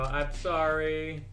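loud, slow, drawn-out sorry; mock wailing apology. Player apologising to game victims, mock wailing. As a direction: drag out a hammed-up apology.

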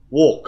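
strong falling beat on "Walk"; stressed word. Shown as a demonstration. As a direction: hit "Walk" hard with a falling tune. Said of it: "có trọng âm".

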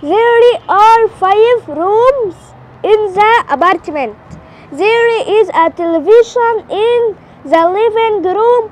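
slow, word-by-word English reading; very slow, careful read of a textbook sentence; slow reading aloud. Acted. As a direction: read it out slowly like a beginner. Girl slowly reads English sentences.

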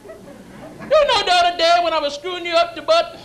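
Cajun man calling to a buddy; chatty mock-Cajun voice; Cajun accent. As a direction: put on a broad Cajun accent. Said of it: "A Cajun homosexual?".

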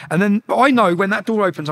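ordinary delivery

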